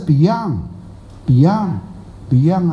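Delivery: Beyond，Beyond，Beyond drawn out slowly and carefully; slow, deliberate repeat of Beyond，Beyond，Beyond for the class; our way of saying it. Shown as a demonstration. Master showing students his Beyond, slow and careful. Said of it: "我们是Beyond".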